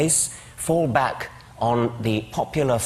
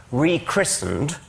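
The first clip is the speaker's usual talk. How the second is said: Rechristened said slowly with a higher start; pointed word choice; 5 semitones higher, slower than usual. Real, not acted. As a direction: lean on Rechristened, slow and deliberate. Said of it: "I choose the word advisedly".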